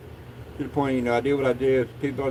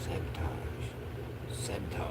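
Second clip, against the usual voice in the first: muttered; 6 semitones lower, 12 dB quieter than usual. Real, not acted. Mutters about game rule after loss.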